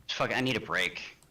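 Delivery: normal talking voice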